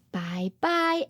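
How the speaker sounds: ordinary delivery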